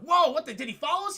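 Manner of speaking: high-pitched startled questioning; retold jumpy, high exclamation; mock alarm. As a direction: act out sudden worry.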